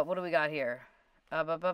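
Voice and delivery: normal talking voice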